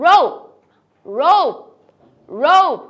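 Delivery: Rope with a long O, final E silent, loud. Shown as a demonstration. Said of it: "লাস্টে ই থাকলে silent".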